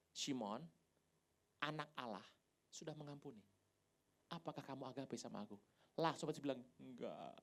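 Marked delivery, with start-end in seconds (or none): 0.1-0.7 low, unforced calling of a name; gentle call. Acted. Jesus calls Peter to restore him, gentle. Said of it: "Jadi panggil pertama sudah enak". 1.6-5.5 soft, unhurried opening, then a quiet question; gentle restoring voice. Acted. Jesus restores guilty Peter, gentle and soft. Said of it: "Jadi panggil pertama sudah enak". 6.8-7.4 soft, low admission; meek reply. Acted. Peter answers Jesus at once, meek and quiet.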